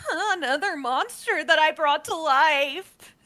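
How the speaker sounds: high, small gnome voice peeking out; timid gnome apprentice. Acted. Game master voicing a gnome hiding in cloth, high and timid. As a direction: squeak it out like a frightened little gnome. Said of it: "And she goes".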